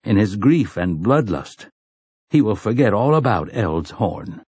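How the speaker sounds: usual speaking voice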